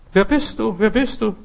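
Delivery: mock calling out. Voice from the mountain to the child, mock high call. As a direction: lightly imitate a call from the mountain. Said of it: "Er schreit aus dem Berg".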